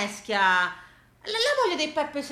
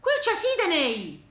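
Pompilio marvelling; 5 semitones higher than usual. Retold or imitated. Pompilio showing Gina Sydney on the globe, high amazed voice. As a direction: call to Gina in wonder over the globe. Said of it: "e diceva".

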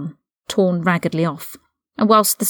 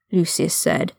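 usual speaking voice

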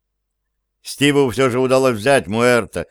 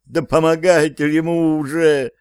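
higher, pleading desperate cry; desperate shout; 5 semitones higher than usual. Acted. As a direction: yell for help in despair. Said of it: "в отчаянии закричала Эмма".

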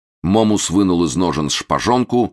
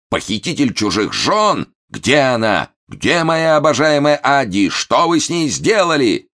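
high, outraged melodramatic tirade; yelling; 10 semitones higher, 4 dB louder than usual. A narrator's reading. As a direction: wail accusations like a wronged husband. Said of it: "заорал Момус". (надрывно орёт, изображая обманутого мужа)

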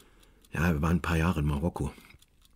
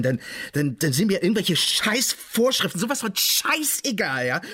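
furious outburst; 13 semitones higher, slower than usual. Real, not acted. [name] bricht aus, Wut.

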